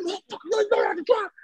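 very high, strained mock rant; road rage. Host mimicking friend yelling at another driver, high road-rage screech. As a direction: imitate a raging driver in a squealed voice. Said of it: "but he's like".

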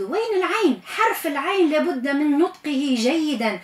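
ordinary talk

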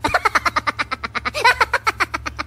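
hammed-up stuttering sobs; long juddering mock crying; khóc mếu. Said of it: "Tôi khóc lớn hơn luôn". (khóc nấc giả, kéo dài)

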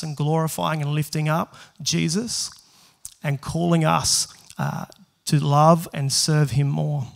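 earnest, unhurried prayer. Acted. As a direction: pray over the people before the sermon. Opening prayer before sermon.

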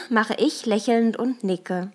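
ordinary delivery, nothing marked

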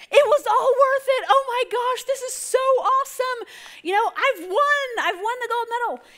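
high, overjoyed wailing cheer; hammed-up tearful elation. Olympian's parent celebrating in the stands, hammed-up tearful elation. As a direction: cry out in mock triumph. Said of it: "they're crying".

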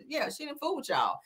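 usual speaking voice

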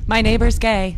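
high, loud caller voice; mock 911 caller. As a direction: call it in like a tattletale.

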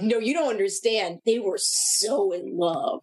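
mock-earnest plea slowing to a drawn-out "so in love"; insist, then stretch out the romance; mock-gushy, slowing right down; gushing insistence. Acts out episode insisting on romance.

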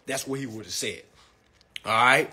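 ordinary talk, nothing marked

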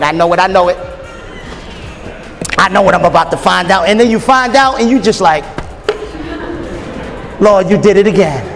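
ordinary talk, nothing marked

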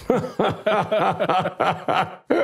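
long, high-pitched burst of laughter; hearty laugh. Real, not acted. Guest laughs after telling host he refused the Lord, amused laughter.

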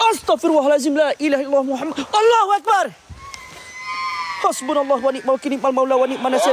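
high, urgent praying in fear; panicked prayer. Real, not acted. Host prays in panic after hearing crying, high. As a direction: cry out prayers in alarm.

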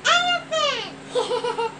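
drawn-out giggly elephant; laughing. Acted. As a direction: laugh through the word.

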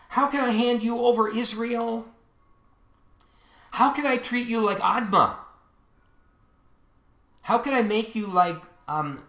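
high-pitched, fervent preacher's declamation; impassioned scripture reading. Acted. Impassioned reading of God's words.

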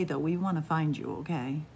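usual speaking voice

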